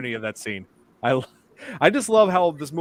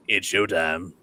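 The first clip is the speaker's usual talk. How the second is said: low, rasping ghoul impression; Beetlejuice; 7 semitones lower, slower than usual. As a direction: drop low and growl the catchphrase. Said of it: "I mastered, uh, Beetlejuice".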